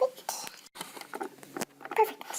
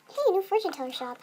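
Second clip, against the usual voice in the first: ordinary delivery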